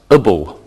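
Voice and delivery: "ibble" as "uh-bul", two short syllables; suffix pronunciation. Shown as a demonstration. Teacher showing students the A B L E ending, "ibble" said "uh-bul". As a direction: say "ibble" with a neutral first vowel. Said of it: "which is pronounced".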